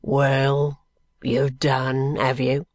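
low, sullen brickmaker; surly and unimpressed; morose. A narrator's reading. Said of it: "said morosely".

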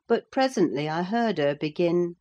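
plain reading voice

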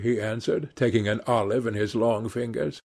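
ordinary delivery

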